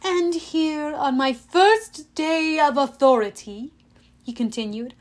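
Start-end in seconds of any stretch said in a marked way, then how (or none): 0.0-3.7 higher, slow, grandiose address; drawn-out self-important oratory; pompous villain speech. A narrator's reading. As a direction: relish your new authority.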